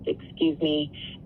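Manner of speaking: ordinary talk, nothing marked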